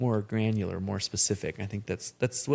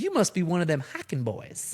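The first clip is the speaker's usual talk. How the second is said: twang it like a Lubbock mom; West Texas accent; 8 semitones higher than usual. Retold or imitated. Mom teasing the hiking friends in a West Texas twang. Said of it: "that real thick, you know, West Texas accent".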